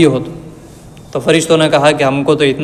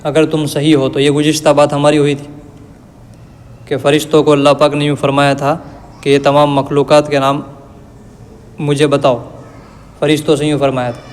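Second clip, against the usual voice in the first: ordinary talk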